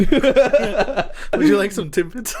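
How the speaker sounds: joking about a Tim Hortons order, a slow, sweet cashier voice. Pretend. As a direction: play a polite Tim Hortons cashier, laughing.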